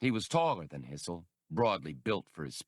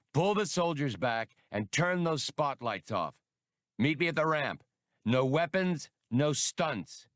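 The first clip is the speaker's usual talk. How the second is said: firm orders; 7 semitones higher than usual. A narrator's reading. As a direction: bark terms down the ramp. Han orders over speaker.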